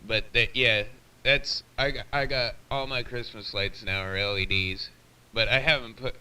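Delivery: usual speaking voice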